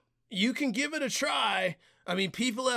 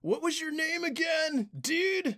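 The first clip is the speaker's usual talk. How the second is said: surfer dude shout; 7 semitones higher than usual. Acted. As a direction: call out high like a surfer dude. Suspicious surfer clerk.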